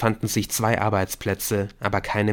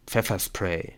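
plain reading voice

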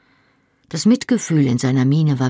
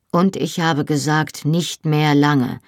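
ordinary delivery, nothing marked

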